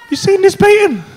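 high, loud amazement; awestruck exclamation. Retold or imitated. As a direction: burst out in wonder at a painting. Awestruck by the painting.

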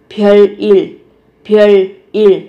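별일 said as byeollil, the l carried over; liaison pronunciation. Shown as a demonstration. Teacher demonstrating the sound change in 별일 for students, carefully articulated. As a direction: sound 별일 with a doubled l, byeol-lil. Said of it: "ở đây biến âm thành riêng".

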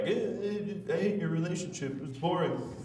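lightly mocking audience voice; mock heckler. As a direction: imitate a dismissive jerk in the crowd. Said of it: "some asshole in the crowd that was like".

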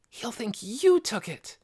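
put-on high, hushed excited boy voice; eager young boy. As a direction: tell it eagerly, keeping it hushed. Scargo reassuring.

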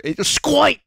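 squirt said high and barked in a mock New York accent; hammed-up New York garbage man saying squirt. Streamer mimics how a New York garbage man says squirt, mock New York accent. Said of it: "That's how a New York City garbage man says the word squirt".

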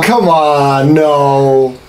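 ordinary talk

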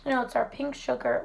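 ordinary delivery

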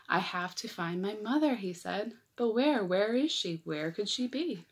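lively picture-book read-aloud; reading to children. A narrator's reading. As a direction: read it like story time for little kids.

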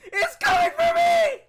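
high, loud panicked laughing; scared horror game streamer. Real, not acted. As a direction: shriek and laugh in fright. Monster chasing him in game.

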